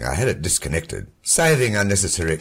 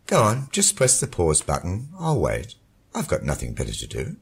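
much lower, cynical male voice; cynical husband; 12 semitones lower, 3 dB louder than usual. A narrator's reading. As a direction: needle her with cool cynicism. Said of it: "The voice had adopted a cynical tone".

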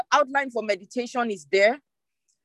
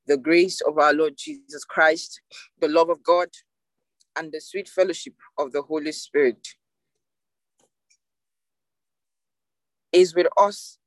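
benediction; 5 semitones lower than usual. Acted. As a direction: pronounce the blessing over the congregation. Leads the closing benediction.

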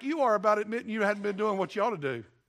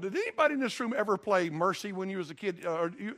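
ordinary talk

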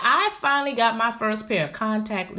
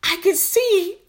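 high, louder thrilled cry of wonder; giddy amazement; 5 dB louder, slower than usual. Retold or imitated. A student trying new contact lenses, high thrilled wonder. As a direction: gasp out the joy of seeing clearly. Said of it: "I was like".

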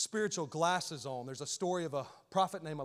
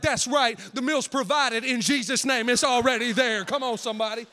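triumphant proclaim; 3 semitones higher, 7 dB louder than usual. Retold or imitated. Triumphant declaration.

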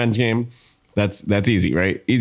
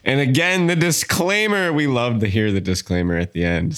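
usual speaking voice

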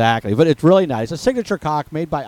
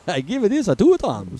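hammy fake Italian, pitched up; mock Italian accent; 6 semitones higher than usual. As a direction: ham up a bad Italian accent. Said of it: "say it in your best Italian accent".

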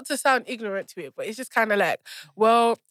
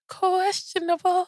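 dramatic pause; 8 semitones higher, slower than usual. Real, not acted. Drawn-out for comic effect.

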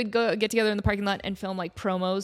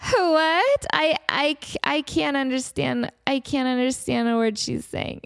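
confused idol on phone; 4 semitones higher, slower than usual. Retold or imitated. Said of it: "her just being like".

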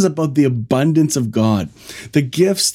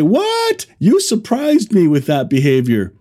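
a squeaked high What, then back to an easy voice; a cartoonish yelp of surprise; mock astonished yelp; 5 semitones higher than usual. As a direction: play a shocked parent catching a misdeed, hammed-up. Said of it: "He's not gonna say".

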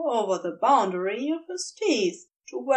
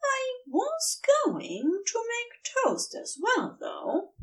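higher, slower, coaxing hint; wistful Aziraphale; 12 semitones higher than usual. A narrator's reading. Aziraphale hints to Crowley he wanted toast, higher and slow. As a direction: angle for toast gently.

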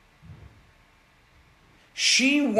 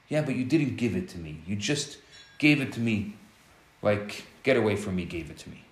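hurt wife; 8 semitones lower, 6 dB quieter, faster than usual. Retold or imitated. Wife reproaching her husband, lower, softer and hurt.

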